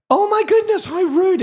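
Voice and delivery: high, hammed-up shocked gasp of offence; teacher feigning shock at a rude child; mock outrage. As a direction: squeal it in mock horror. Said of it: "And I was like".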